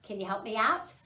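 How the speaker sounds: usual speaking voice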